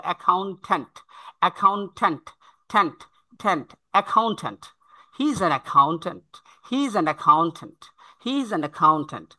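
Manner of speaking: "accountant" as "a-khaun-thant"; aspirated C and T. Shown as a demonstration. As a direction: drill "accountant" with a breathy kh. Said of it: "খয়ের মতো হবে". ("accountant" বলুন "অ্যাখাউন্ঠ্যান্ট")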